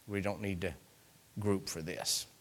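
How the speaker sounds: low, hushed aside; offhand aside. Acted. Preacher replaying the scouts' report to the congregation, low offhand aside. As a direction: drop it right down, almost to yourself.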